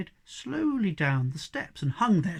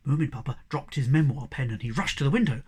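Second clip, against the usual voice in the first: plain reading voice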